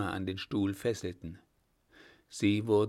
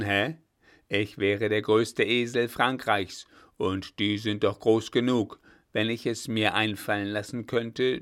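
put-on loud scornful old general; angry rebuke; 5 dB louder than usual. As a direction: scold the mayor down loudly. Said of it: "warf ihm einen zornigen Blick zu".

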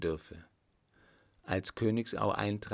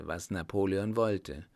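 plain reading voice

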